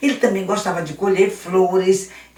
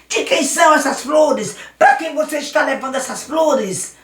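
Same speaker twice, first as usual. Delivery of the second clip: put-on higher, louder bully boy demanding answers; 7 semitones higher, 3 dB louder than usual. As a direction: corner the little boy. Luiz confronts Daniel.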